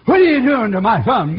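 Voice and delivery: loud confrontation; father demanding answers from the boys; angry father. Retold or imitated.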